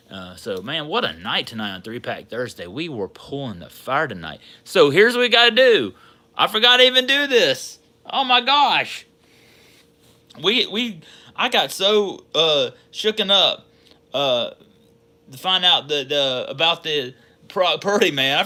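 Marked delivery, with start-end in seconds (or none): 4.6-13.7 higher, louder burst of excitement; suddenly remembering, all fired up; excited outburst. Real, not acted. Suddenly remembers the groundhog bit.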